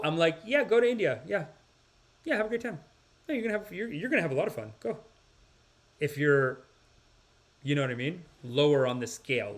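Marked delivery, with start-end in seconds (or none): 0.4-5.0 higher, breezy send-off; dismissively upbeat, acted; mock encouragement. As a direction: wave them off with fake cheer. Breezy send-off, acting own advice.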